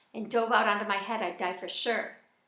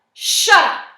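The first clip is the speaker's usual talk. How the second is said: much louder, higher furious yell; angry shout; 9 semitones higher, 20 dB louder than usual. Acted. Alan shoving his brother to make him stop, angry shout. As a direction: snap it out to silence him. Alan shoves Joey, yells.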